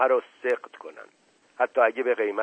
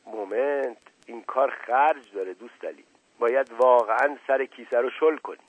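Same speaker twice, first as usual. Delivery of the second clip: ordinary delivery, nothing marked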